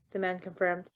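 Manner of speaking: plain reading voice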